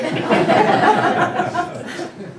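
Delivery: loud high laugh, falling. Real, not acted. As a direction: laugh at the punchline. Laughing at Rick's joke.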